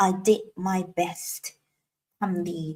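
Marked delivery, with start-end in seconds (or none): 0.1-1.4 "I did my best" drawn out word by word; slow model. Shown as a demonstration. Teacher models "I did my best" slowly for the class. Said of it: "เราก็จะทำเป็น I did my best".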